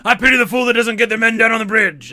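big hammed-up tough-guy shout; mock-bellowing celebrity impression; Mister T. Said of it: "Mister T would play all the".

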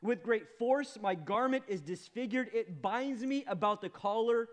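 building to loud and high lament; preacher reading Job's lament, rising; anguished reading. Acted. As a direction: read it as a man in torment. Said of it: "Verse fifteen says this".

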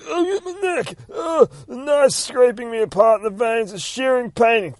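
high, loud hammed-up death cries; dying monster wail.

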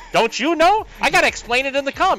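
high, loud pleading; mock exasperation. Confused reporter retelling his plea to the gambling writer, mock exasperation.

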